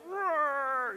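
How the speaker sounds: a high, tiny mock roar; small high imitated roar; little cub roar. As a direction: squeak it out like a cub trying to roar. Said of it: "the little bear can't do that. So he's like".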